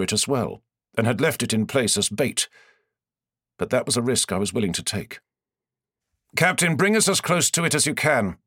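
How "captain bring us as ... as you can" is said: firm, raised voice of a commander giving orders; commanding lord. A narrator's reading. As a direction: give the order like a lord on his ship. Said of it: "I instructed".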